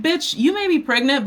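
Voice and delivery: high-pitched indignant retort; acted-out indignation; sassy comeback. Retold sassy comeback to coworker.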